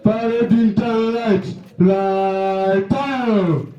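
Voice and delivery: drawn-out parade ground commands; drill commands. Acted. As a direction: call each order to the whole parade.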